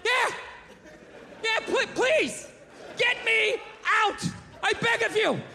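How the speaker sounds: high-pitched, drawn-out begging; desperate pleading. Acted. Captive gorilla begs for escape, desperate and high. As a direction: beg to be let out, voice pitched right up.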